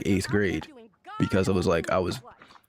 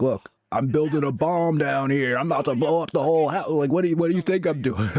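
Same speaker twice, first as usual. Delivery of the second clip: sarcastic retort; 8 semitones higher than usual. Retold or imitated. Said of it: "it's like".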